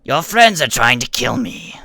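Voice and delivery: loud yell in a put-on character voice; acted yell. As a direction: yell it out as an accusation. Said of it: "he yells out".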